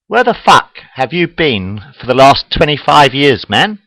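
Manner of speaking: loud, exasperated rant, slowing to punch it; angry rant. Real, not acted. Comic rants at end of world believers, loud and exasperated. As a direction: shout down the doom believers.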